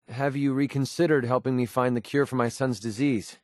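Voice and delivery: ordinary delivery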